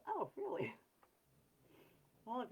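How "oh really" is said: high, sarcastic oh really; a much higher sarcastic oh really to the surgeon; mock surprise. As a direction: play it wide-eyed and sarcastic. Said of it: "I'm like".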